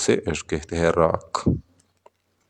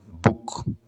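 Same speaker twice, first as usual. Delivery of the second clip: whispered word; 7 dB quieter, much slower than usual. Shown as a demonstration. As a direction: whisper बुक softly without voice. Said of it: "बुक में एक syllable है". (फुसफुसाकर "बुक")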